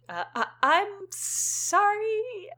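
slow, high, stammering and scared; stuttering apology. Acted. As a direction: stammer it out like a frightened kid. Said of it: "you stutter".

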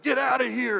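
high-pitched mock yell chasing kids off; imitated angry yell. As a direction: play the irate neighbor shooing kids away. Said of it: "Maria would chase the kids down the street and be like".

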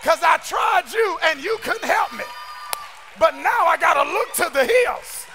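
loud, high, shouted preaching cadence; preaching at full force. Acted. Preacher shouting to the congregation, full-force sermon delivery.

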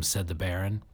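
ordinary delivery

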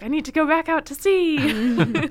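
high, loud, laughing mock pirate line; laughing quote. Host voices Stede Bonnet wanting the sea, laughing. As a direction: laughs while voicing the pirate's whim.

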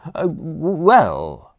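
stammering, caught without an answer; faltering. A narrator's reading. Young man clueless of butler's plan, faltering. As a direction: stumble into the word, stalling for time. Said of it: "I faltered".

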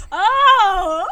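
high, loud drawn-out "oh". Acted. Player's character reacts to the street trouble, loud exclamation. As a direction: cry out a big "oh" in character.

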